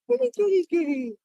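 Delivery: high, sing-song kitty call; calling a cat. Real, not acted.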